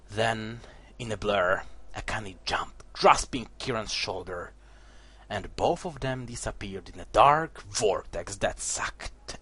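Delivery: low, soft dramatic narration, fading to a whisper; hushed storyteller voice; story narration. A narrator's reading. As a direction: read the lore like a dark tale. Reads the game's story text.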